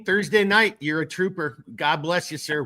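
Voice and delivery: ordinary talk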